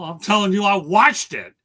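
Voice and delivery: insist, voice up, you were there; heated insistence. Real, not acted.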